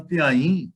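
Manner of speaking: ਧਿਆਈ said carefully as the right reading, falling at the end; ਧਿਆਈ ਦਾ ਸਹੀ ਉਚਾਰਨ; correct pronunciation. Shown as a demonstration. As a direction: model the word ਧਿਆਈ for the class. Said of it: "ਇਹਨੂੰ ਧਿਆਈ ਨਹੀਂ ਪੜ੍ਹਨਾ".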